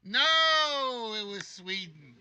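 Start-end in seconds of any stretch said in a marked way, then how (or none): 0.1-1.1 high, loud disappointed No; loud groan. Real, not acted. Host groans as contestant misses the question. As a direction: wail it like the answer hurt.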